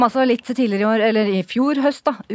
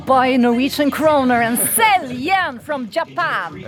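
trader slogan; 5 semitones higher, slower than usual. Pretend. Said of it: "Kanskje man sier nå".

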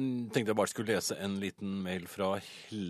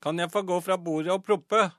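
put-on higher, louder child's voice, carefully enunciated; loud, clear nephew; 6 semitones higher, 7 dB louder than usual. Nephew asking his mother at the table, loud and clear. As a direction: pipe up like a little boy asking permission. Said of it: "spurte høyt og tydelig".

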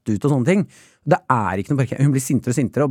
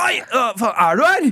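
high, jolted exclamation; mock shock at being overheard; imitated startled mother; 8 semitones higher than usual. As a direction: yelp it, caught out. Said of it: "jeg hører at mamma bare".

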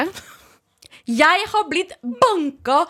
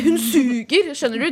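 usual speaking voice

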